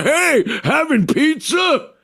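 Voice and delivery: high, goofy shouted greeting. Retold or imitated.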